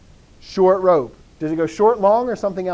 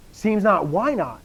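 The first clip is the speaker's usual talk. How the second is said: ordinary delivery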